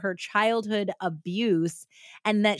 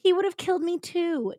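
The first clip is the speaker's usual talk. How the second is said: high-pitched, understated tearful voice; tearful babbling; 10 semitones higher than usual. Retold or imitated. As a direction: protest through mock tears, pitched up. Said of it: "Tears flowed as she babbled".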